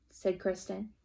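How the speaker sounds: usual speaking voice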